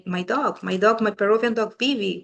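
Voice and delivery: ordinary delivery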